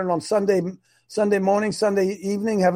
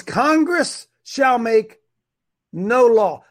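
1 slow emphatic reading; 4 semitones higher, 4 dB louder, much slower than usual. Shown as a demonstration. Reads the amendment emphatically.

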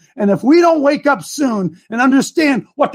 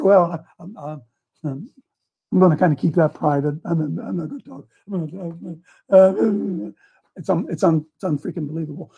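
mock evasive pastor; 9 semitones lower, 6 dB quieter than usual. Pastor dodging the vote question.

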